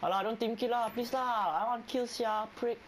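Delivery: high whining plea to teammates; whiny pleading. Real, not acted. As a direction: whine at them to stop stealing kills. Begging teammates not to steal kills.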